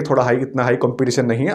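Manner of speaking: ordinary talk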